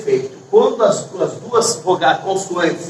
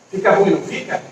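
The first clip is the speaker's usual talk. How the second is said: ordinary delivery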